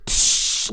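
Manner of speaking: sharp voiceless hissed crash; shattering glass noise. Retold or imitated. Showing how the backboard broke, glass crash noise. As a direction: imitate glass smashing with your mouth. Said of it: "like, shattered glass".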